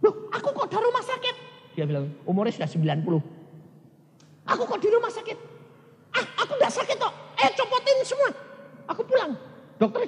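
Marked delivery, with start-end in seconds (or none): 0.1-1.4 put-on high, quick, laughing old woman's voice; surprised old mother. Ninety-year-old mother waking in hospital, high and laughing. 4.5-9.4 put-on high, quick, laughing old woman's voice; surprised old mother. Ninety-year-old mother waking in hospital, high and laughing.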